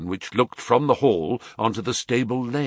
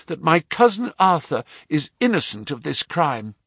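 put-on higher, pleading young lady's voice; anxious young woman; 7 semitones higher than usual. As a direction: beg him to believe you. Mary addresses Holmes.

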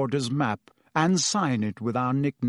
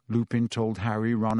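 ordinary delivery

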